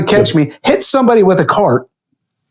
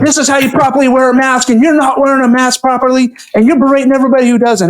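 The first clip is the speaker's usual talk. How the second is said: higher, lecturing rant, faster at the end; mock lecturing yell; 8 semitones higher, faster than usual. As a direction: scold her in front of everyone. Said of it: "I started yelling the top of my lungs".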